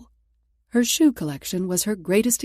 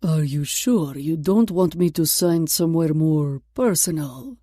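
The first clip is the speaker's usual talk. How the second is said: put-on slow, lower, flirting Russian accent; thick Russian accent; 3 semitones lower, 4 dB louder than usual. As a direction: lean in with a leering Russian accent. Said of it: "His thick Russian accent".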